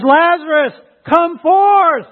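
loud, high, drawn-out summons; commanding call. Retold or imitated. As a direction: call out with authority to raise the dead. Jesus calls dead Lazarus out.

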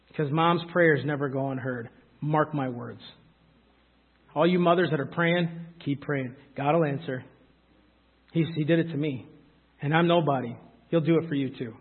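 low, soft, sincere assurance; tender reassurance. Real, not acted. Preacher to praying mothers in the congregation, tenderly reassuring. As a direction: gently encourage them, voice dropped low.

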